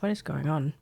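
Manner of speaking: usual speaking voice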